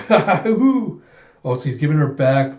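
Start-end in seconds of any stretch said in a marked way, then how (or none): 0.1-1.0 high, loud cackle; manic laugh. Acted. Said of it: "And you see Alita going".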